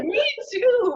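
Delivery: high, laughing mock outburst; high-pitched playful protest; mock exclamation. Acted exasperated reply to starving roommate.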